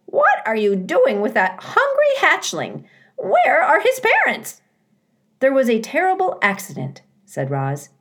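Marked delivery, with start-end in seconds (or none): 0.0-4.6 high, loud, indignant squawk; scolding goose, climbing much higher; squawking old goose. Acted. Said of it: "squawking to the robot". 5.4-7.0 high, quick, upbeat delivery; incongruously perky robot voice; cheerful robot. Acted. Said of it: "why does your voice sound so cheerful".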